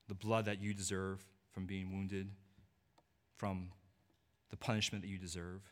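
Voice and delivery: quiet awe-filled preaching; hushed tender preaching. Acted. Tender low-voiced preaching on grace.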